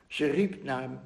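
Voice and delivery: normal talking voice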